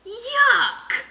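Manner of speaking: high, loud grossed-out yuck; disgusted yuck. A narrator's reading. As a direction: pull away from the bugs in disgust. Narrator disgust.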